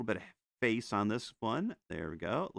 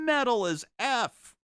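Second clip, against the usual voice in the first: mock hype; 13 semitones higher, 8 dB louder, slower than usual. Joking emphasis on metal.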